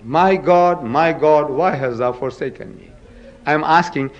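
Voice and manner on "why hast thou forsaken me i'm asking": ordinary delivery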